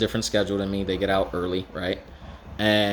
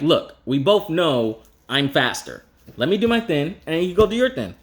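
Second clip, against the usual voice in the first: put-on annoyed kid brother; 7 semitones higher than usual. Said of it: "which would upset me because I'm like".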